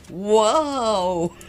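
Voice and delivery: a surprised wow that spills into laughter; overjoyed laughter over the gift; delighted laugh. Acted.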